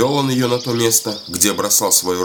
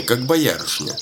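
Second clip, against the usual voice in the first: ordinary delivery, nothing marked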